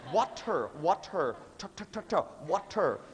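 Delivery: "Water" with a hard, popped t; "water" split crisply, t sharply aspirated; over-enunciated consonants. Shown as a demonstration. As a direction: drill the t in "water" like a fussy elocution teacher. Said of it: "I cannot hear your consonants".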